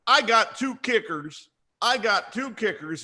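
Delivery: "I got two kickers" repeated insistently, pitched high; emphatic repetition. Pretend. Coach emphasizing his kicker count, high insistent repetition. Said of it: "You see how I'm emphasizing?".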